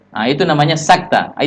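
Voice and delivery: ordinary delivery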